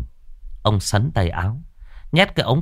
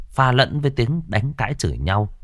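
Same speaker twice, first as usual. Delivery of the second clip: plain reading voice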